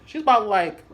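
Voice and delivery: ordinary delivery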